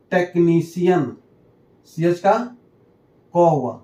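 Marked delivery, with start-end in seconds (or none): none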